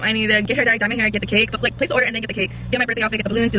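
quick run through the list; fast recap. Real, not acted.

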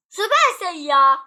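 high, loud burst; loud exclamation. Real, not acted. Player exclaims over a game item, loud exclamation. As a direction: cry it out suddenly, far above talking level.

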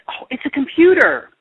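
high, delighted cry of discovery; excited exclamation. A narrator's reading. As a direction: announce the find with lively surprise. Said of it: "exclaimed Missus Jules".

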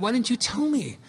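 alarmed question. Retold or imitated. Son hears mother's hidden cancer.

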